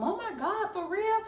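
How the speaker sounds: high mock-dramatic gasp; mocking fake concern. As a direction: parody his over-the-top reaction. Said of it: "you over someone saying".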